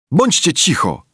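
plain reading voice

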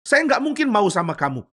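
high, indignant protest; startled, high-pitched objection; shocked refusal. Acted. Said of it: "Yusuf kaget".

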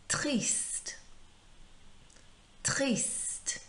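"triste" pitched high and drawn out, with the final T sounded; model pronunciation. Shown as a demonstration. Teacher modelling "triste" for pupils to repeat, drawn-out careful pronunciation. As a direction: say "triste" high and slowly for copying. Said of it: "repeating after me".

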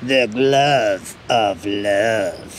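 low, slow, drawn-out mock-grand voice; hammed-up catchphrase.

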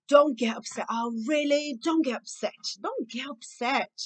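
put-on impatient parent dismissing a child's upset; exasperated scolding. Shown as a demonstration. (bronca impaciente e enfática)

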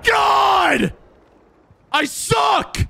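high, loud shout of self-disgust; real angry gamer outburst; frustrated shout. Real, not acted. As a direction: yell it at yourself after losing. Loses the sniper battle.

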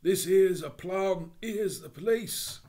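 slow, loud, cornered Cockney cry; a desperate burglar hearing police boots. Acted. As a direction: sound trapped and done for.

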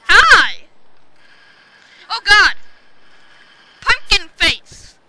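loud, high exclamations; excited shouting. Real, not acted. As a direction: yell out at another player mid-game. Yelling at player in game.

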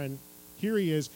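ordinary talk

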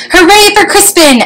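high, happy storybook hooray; shouted cheer. A narrator's reading. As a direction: cheer it like a whole crowd celebrating. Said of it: "everyone shouted".

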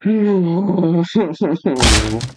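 long hooting laugh after losing; high held hoot breaking into laughs; drawn-out laugh. Real, not acted. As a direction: laugh helplessly at your own defeat. Despairing reaction after dying in game.

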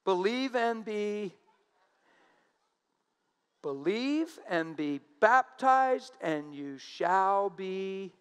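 Believe, pause, and be baptized, drawn out slowly; slow emphatic quote. Shown as a demonstration. Preacher to congregation, slow deliberate quoting. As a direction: spell out each part of the verse slowly. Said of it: "He didn't say".

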